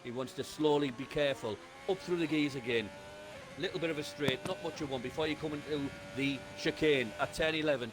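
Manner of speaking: brisk running race commentary; live play-by-play. Acted. Co-driver talking the viewers through the lap, commentary voice. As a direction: call every corner of the lap as it happens.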